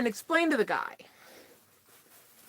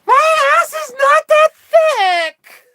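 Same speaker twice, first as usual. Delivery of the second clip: high, slow, loud protest; indignant shout; 9 dB louder, much slower than usual. Real, not acted. Shouting back at a teasing viewer, indignant and loud. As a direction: yell it out, stretched and outraged.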